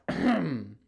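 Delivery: loud throat clear used as a name. Shown as a demonstration. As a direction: hack out a throat clear like calling someone. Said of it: "That's his name".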